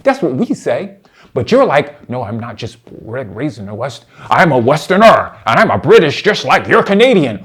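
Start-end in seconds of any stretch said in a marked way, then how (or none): 2.1-7.4 low start bursting into loud declaration; pompous imitation that swells on the identity claim; mock proud Westerner. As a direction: mimic a self-important boast, getting louder. Mimics opponent claiming Western identity.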